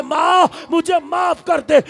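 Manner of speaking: high, loud begging cry; pleading cry. Acted. Son begs his fallen mother for forgiveness, crying out loud. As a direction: beg her forgiveness at full voice.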